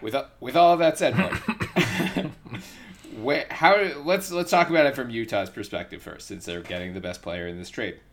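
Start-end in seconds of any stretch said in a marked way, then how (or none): 1.4-2.6 breathy laugh. Real, not acted. Laughs at long explanation.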